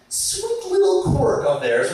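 high, drawn-out sarcastic sweetness; sarcastically sweet. Real, not acted. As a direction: stretch it out high, mock-fond. Sarcastic about drivers' dangerous habit.

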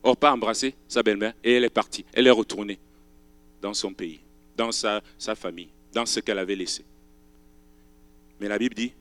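usual speaking voice

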